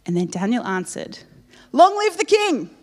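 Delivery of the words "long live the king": high, loud ringing cry; triumphant cry. Acted. Daniel answering the king from the den, triumphant. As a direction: proclaim it like a victory. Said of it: "Oh, okay. I thought you would have been like".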